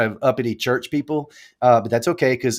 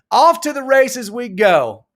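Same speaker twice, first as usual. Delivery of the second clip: big send-off; 10 semitones higher, slower than usual. Pretend. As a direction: announce it slowly like a race caller. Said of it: "repeats the send-off line".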